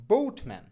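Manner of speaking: বোটম্যান with a gliding "bout" vowel, loud first beat; corrected pronunciation. Shown as a demonstration. Teacher drilling boatman with learners, বোটম্যান with "ou" glide. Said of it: "অর্থাৎ".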